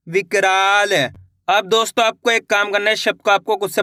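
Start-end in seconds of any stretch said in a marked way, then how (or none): none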